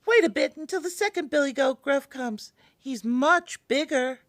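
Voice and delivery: very high, tiny bargaining voice; small goat voice. A narrator's reading. Tiniest goat bargains with the troll, in a small high voice. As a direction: squeak it as a little goat talking its way out. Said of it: "with such a small voice".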